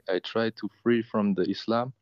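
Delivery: ordinary talk, nothing marked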